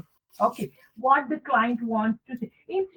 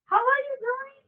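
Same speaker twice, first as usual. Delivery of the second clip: much higher, bright and light; high friendly question; 13 semitones higher, 4 dB quieter than usual. Shown as a demonstration. Phoning a best friend, bright and high. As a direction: ask it sweetly, up high.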